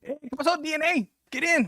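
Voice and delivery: high mocking buddy greeting; teasing friend. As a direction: pitch it up like a friend ribbing you. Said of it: "he's like".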